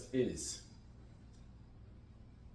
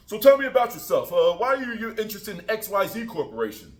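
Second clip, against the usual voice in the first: loud, higher boss questioning; boss interviewer; 6 semitones higher, 8 dB louder than usual. Acted. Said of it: "Bob comes in".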